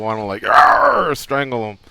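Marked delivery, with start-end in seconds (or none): 0.4-1.1 frustrated growl. Retold or imitated. Wanting to strangle a friend.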